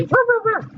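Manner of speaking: high-pitched mock dog ruffs; imitated dog bark. Teacher showing students what bark means, imitated barking. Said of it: "Don't bark".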